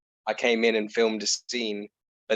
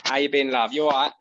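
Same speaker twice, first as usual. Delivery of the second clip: matey cockney, a little higher; thickened East London accent; 3 semitones higher than usual. Retold or imitated. Guest greeting housemate after filming, thick East London accent. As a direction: greet like an EastEnders local. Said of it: "you can tell that you've spent a day filming".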